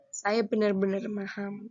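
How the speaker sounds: ordinary delivery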